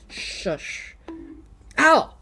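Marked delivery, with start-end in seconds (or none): 1.8-2.1 sharp ow. Real, not acted. Getting bonked on stream.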